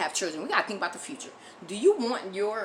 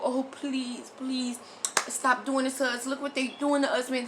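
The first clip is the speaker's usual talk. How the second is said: high, whiny begging; pleading victim voice; 6 semitones higher than usual. Retold or imitated. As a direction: beg them in a pitiful high voice. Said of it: "when you go".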